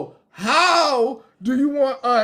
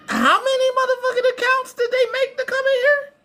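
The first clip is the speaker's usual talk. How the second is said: incredulous; 12 semitones higher, 3 dB louder than usual. Real, not acted. Incredulous rant at trolls.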